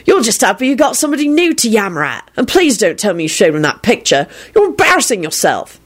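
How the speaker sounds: high, exasperated scolding; disgusted. A narrator's reading. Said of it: "Sirius replied disgustedly".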